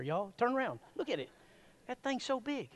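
usual speaking voice

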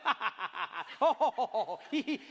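real laughter breaking through; laughing alone. Retold or imitated. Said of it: "I was doing that in the truck".

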